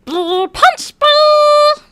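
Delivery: shrieked high and loud like a cartoon; loud squeal. Friends joking, squealing the line loudly. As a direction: squeal it out at the top of your voice.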